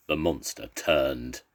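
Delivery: ordinary delivery, nothing marked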